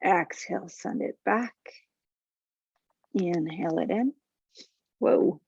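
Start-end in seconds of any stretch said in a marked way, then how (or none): 5.0-5.5 losing balance. Real, not acted. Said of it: "Oh, I'm losing my balance today".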